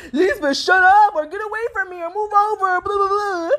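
high-pitched imitation of a snappy kid; mock aggressive brother. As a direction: rattle off a brother's rude brush-offs. Imitates hostile little brother snapping.

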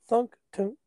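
ordinary delivery

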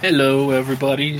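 blocked nose, stuffy; blowing nose. Real, not acted. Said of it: "Sorry, I'm blowing my nose".

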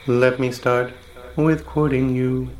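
ordinary talk

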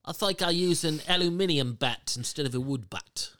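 amateur British English accent. Shown as a demonstration. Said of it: "how would they say it in their accent".

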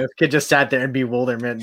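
ordinary delivery

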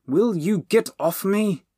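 slow, high, loud exasperation; indignant, drawn-out protest; cross. A narrator's reading. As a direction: snap crossly at them. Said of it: "Bernie said crossly".

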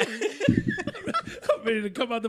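high, playful put-on eagerness; mock eager voice. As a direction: lightly play something itching to burst out. Playfully voices what wants out.

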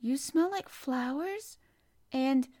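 joyous. A narrator's reading. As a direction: sniff and marvel with joy. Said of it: "he cried out quite joyously".

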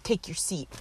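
low-key, softly dropped retold sass; sassy. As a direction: dismiss a passenger with quiet mock sass. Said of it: "her sass is unbelievable".

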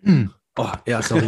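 just swallowed the wrong way; clearing a choked throat; choking on a drink. Real, not acted. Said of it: "hab ich grad verschluckt". (verschluckt, nach Luft ringend)

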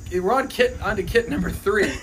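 ordinary talk, nothing marked

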